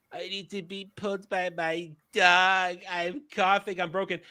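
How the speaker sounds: whiny weakling voice; mocking a feeble hero; mock pathetic whimper.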